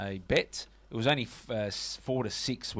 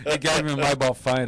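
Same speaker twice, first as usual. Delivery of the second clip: laughing through it, louder; can't hold back the laugh mid-story; 3 semitones higher, 7 dB louder than usual. Real, not acted. Host retelling the mobile phone gift, laughing.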